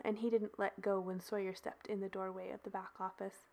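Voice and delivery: ordinary delivery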